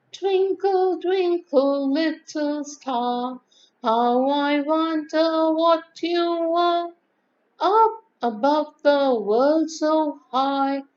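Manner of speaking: slow recitation, starting high and gradually coming down; modulated nursery rhyme. Shown as a demonstration. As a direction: recite slowly with expressive modulation, high at first. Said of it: "if you see with modulation".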